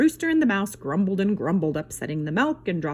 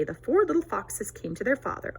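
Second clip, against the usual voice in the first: ordinary delivery, nothing marked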